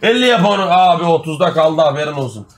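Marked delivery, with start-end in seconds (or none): 0.0-0.6 high, hyped-up pleading; excited urging. Real, not acted. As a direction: egg the donor on excitedly. Hyped by a gifted-subscription donation. (heyecanla, tiz sesle gaza getirerek)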